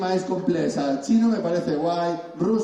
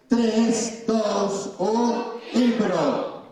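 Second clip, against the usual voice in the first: excited countdown; 7 semitones higher, much slower than usual. Real, not acted. Countdown to start improv.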